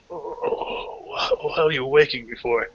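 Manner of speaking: croaky, half-asleep complaint; groggy chieftain. Acted. Chieftain slapped awake grumbling at his men, groggy. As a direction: grumble as if just shaken awake.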